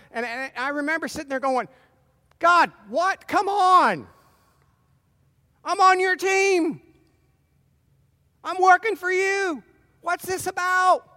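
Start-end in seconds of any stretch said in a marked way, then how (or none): 2.4-11.1 higher, louder exasperated protest; complaining to God. Retold or imitated. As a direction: plead indignantly, hurt and impatient. Retells complaining to God sick.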